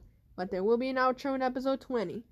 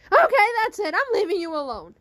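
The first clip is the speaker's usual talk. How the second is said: loud, high storming-off line, much louder; fed-up yell; 7 semitones higher, 10 dB louder than usual. Acted. After the slap she yells she is leaving, loud and fed up. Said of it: "she was like".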